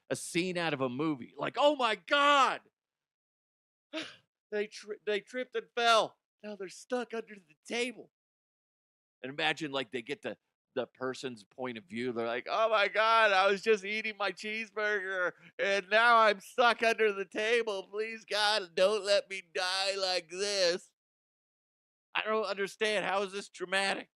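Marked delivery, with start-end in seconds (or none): 1.6-8.1 high, loud hammed-up alarm; overacted witness panicking about the table; mock panic. 12.5-24.1 high, loud hammed-up pleading; overacted trapped diner; mock despair.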